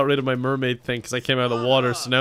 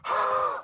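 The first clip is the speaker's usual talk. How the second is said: dramatic gasp; 6 dB quieter than usual. Acted. Character gasps.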